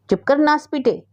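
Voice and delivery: high sharp rebuke to her son; shouting scold. A narrator's reading. Mother shouts down her sulking son, high scolding yell. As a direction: snap at the boy to shut up. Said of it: "राज रानी ने चिल्लाकर कहा".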